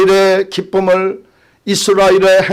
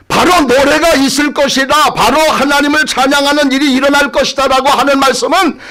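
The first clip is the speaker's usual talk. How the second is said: high-pitched, pressing proclamation; fervent preaching; 8 semitones higher than usual. Real, not acted. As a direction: proclaim it to the congregation in a raised pitch.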